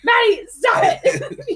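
high, slow laughing plea; laughing "stop it"; retold scolding while laughing. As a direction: beg her to stop through laughter. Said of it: "he goes".

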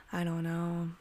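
unvoiced, hopeless whisper. Real, not acted. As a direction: breathe it out, defeated. Whispered resignation.

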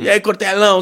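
high, loud mock greeting; rude bro greeting. Fan greets Cortella, mock rowdy call. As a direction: hail the scholar like a drinking pal. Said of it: "Você não vai falar desse jeito".

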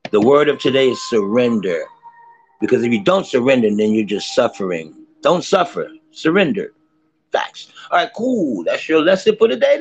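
loud, lively tarot reading; upbeat tarot reading. Acted. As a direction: deliver the card of the day.

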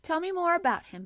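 high, loud eager plea; eager demand. A narrator's reading.

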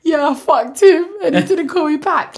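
loud, high mock confession; hammed-up oversharing. Colleagues hearing the podcast confession, hammed-up. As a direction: blurt it out, mimicking podcast candour.

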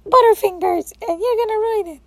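high, loud mock scolding; scolding friend. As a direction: imitate the bossy friend. Friend voiced scolding again.

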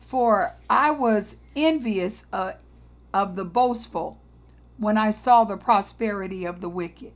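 solemn preacher's scripture reading. Acted. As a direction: read the verses with weight. Reads Psalm aloud.

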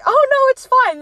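very high, loud mock reassurance; sing-song high brush-off; breezy dismissal. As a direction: imitate airy denial of a disaster. Retold character brushes off damage.

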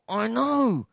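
high-pitched, impatient protest; rising irritation. Retold or imitated. Kid pressed repeatedly by therapist, irritated. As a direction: push the pitch up, fed up. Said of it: "get a little bit angry".

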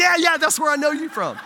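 loud, high-pitched eager confirmation; imitating excited agreement. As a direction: agree in a big rush of recognition, voice way up. Young man's relieved agreement, acted.

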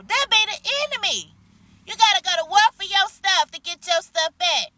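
high, impassioned warning cry; upset. Real, not acted. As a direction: urge them to war, riled up and pitched high. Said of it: "I get upset when I start talking about that".

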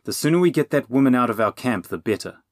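insistent demand, starting loud. A narrator's reading. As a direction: press the point impatiently. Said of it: "Will demanded".

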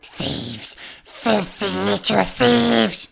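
slow, hissing Gollum voice on filthy little thieves; Gollum impression. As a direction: spit the words like Gollum. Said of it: "lost the ability to do Smeagol".

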